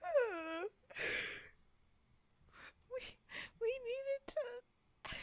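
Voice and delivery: trying to talk mid giggle fit, near tears; laughing so hard she can barely speak. Real, not acted. Laughing fit while reading.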